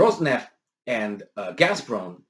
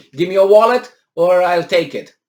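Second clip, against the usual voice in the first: mock mugger demand; 5 semitones higher, 10 dB louder than usual. Mugger analogy for ultimatum.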